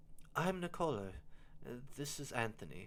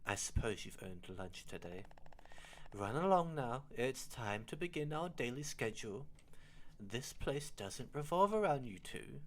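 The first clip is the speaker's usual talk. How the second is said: normal talking voice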